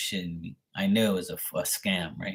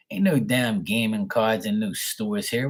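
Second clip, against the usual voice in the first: normal talking voice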